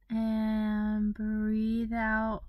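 very slow, sustained words pacing an exhale; long breathing cue. Acted. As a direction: draw each word right out like one long breath. Guiding a second, longer exhale.

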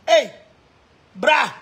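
normal talking voice

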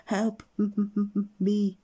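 high, frightened stutter on me; stammered. Acted. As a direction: stutter in fear. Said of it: "he stammered".

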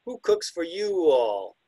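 slow, sing-song "Who cooks for you"; drawn out like the owl's call; slow owl mnemonic. Shown as a demonstration. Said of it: "the classic mnemonic".

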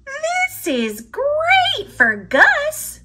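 high, slow hopeful mouse voice; a small mouse proudly showing his find; Pip voice. Acted. Pip to Squeak, showing the gift for Gus, in a high slow hopeful voice.

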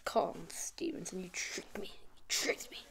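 low, whispered blame; soft whisper, betrayed; whispered accusation. Real, not acted. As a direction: hiss it at the friend who tricked you. Accusing the friend who set it up.

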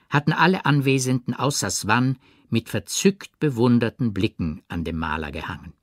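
ordinary delivery

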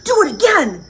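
high, eager demand; excited urging. Acted. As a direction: egg the dragon on. Elizabeth eggs dragon on.

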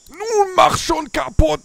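high, loud strained push; straining. Real, not acted. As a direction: force it out with effort. Anstrengung, Scheibe eintreten. (angestrengtes Pressen)

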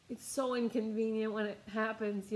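ordinary talk, nothing marked

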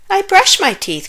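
put-on very high, indignant child's protest; protesting cry. A narrator's reading. Zack protests to dentist about teeth cleaning, high cry. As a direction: object in a high storybook kid voice. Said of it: "cried Zack".

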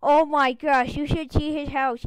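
ordinary talk, nothing marked

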